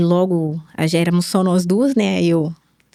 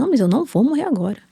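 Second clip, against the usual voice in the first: quick, higher-pitched retort with strong stresses on "não"; firm insistence; 6 semitones higher, faster than usual. Retold or imitated. Mother reassuring her worried daughter after the diagnosis, firm insistence. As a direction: insist quickly, voice jumping up in protest.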